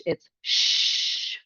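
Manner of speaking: unvoiced sh hush; a sustained sh; sh sound. Shown as a demonstration. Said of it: "two letters that make one sound".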